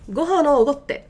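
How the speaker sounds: playful nudge to a friend; casual pleading request. Shown as a demonstration. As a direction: coax a friend into paying.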